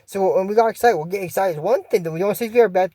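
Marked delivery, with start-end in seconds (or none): none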